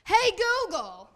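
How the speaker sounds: high, loud mock holler with Jersey accent; New Jersey mom calling out. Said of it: "you'll just randomly hear".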